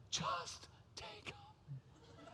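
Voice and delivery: hushed whisper. Retold or imitated. Wife quietly urging her husband to drive the son, whispered. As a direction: breathe it out of earshot.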